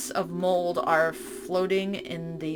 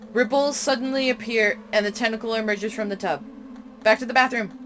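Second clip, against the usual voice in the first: loud, urgent, higher panicked reading; 4 semitones higher, 4 dB louder than usual. Real, not acted. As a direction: read it like you're being chased. Tentacle attack, panicked reading.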